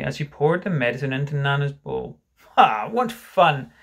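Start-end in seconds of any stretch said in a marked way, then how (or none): 2.6-3.7 loud, slow, drawn-out forced cheer; doubtful cheer. Acted. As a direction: stretch out the fun without quite believing it. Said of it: "he said doubtfully".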